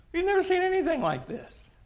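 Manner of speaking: high, amazed crowd exclaiming. Retold or imitated. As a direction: marvel aloud at the miracle.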